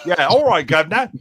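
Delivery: loud, high American stage Cockney; theatrical Cockney. Shown as a demonstration. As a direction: belt out a hammy Cockney. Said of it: "it's definitely American theater Cockney".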